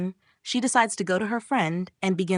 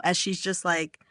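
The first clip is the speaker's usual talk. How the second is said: ordinary delivery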